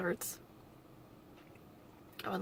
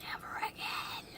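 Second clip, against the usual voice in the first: whispered vow; 8 dB quieter than usual. Real, not acted. Vowing never to return.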